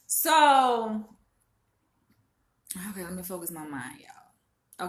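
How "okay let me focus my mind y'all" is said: whispered to herself, gathering her thoughts. Real, not acted. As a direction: breathe it out under your breath to calm down. Collecting herself before telling the story.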